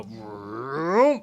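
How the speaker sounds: loud vroom burst like an engine revving; engine roar. Retold or imitated. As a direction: rev up like anger firing. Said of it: "it made me go".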